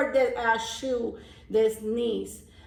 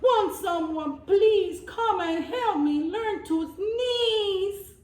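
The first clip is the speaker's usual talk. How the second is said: high, tearful goat's plea; crying out; 8 semitones higher, slower than usual. A narrator's reading. Goat sobbing to the world for help, high and tearful. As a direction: cry out for help, voice rising high. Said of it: "He cried out".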